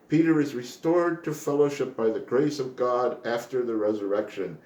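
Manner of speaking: usual speaking voice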